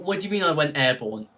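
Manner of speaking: ordinary talk, nothing marked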